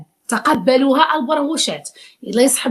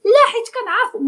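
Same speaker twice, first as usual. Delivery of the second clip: mock girl; 11 semitones higher than usual. Mock girl answering back.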